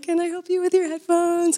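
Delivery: high, singsong offer of help; eager offer. Retold or imitated. Offering a stranger help with headphones, high-pitched and eager.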